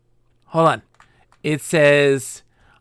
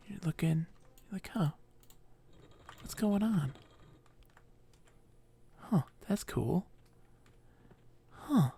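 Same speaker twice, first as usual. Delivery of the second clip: curious explorer; 5 semitones higher, 11 dB quieter than usual. Pretend. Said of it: "And you're just like".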